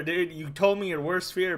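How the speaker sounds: ordinary talk, nothing marked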